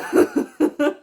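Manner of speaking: high burst of laughter; loud laugh. Real, not acted. Vlogger cracking up at her own joke, loud laugh. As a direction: cackle loudly at herself.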